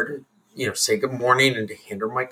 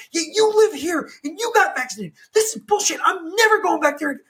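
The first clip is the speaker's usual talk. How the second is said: imitated high-pitched angry rant; high, indignant tirade as the retold customer; losing her shit; 13 semitones higher than usual. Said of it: "hear that woman lose her shit".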